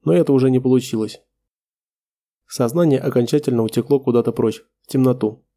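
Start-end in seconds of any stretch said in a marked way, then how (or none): none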